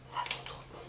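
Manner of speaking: breathless whisper of delight. Real, not acted. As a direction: whisper it, enraptured. Sniffing the scrub, enthralled.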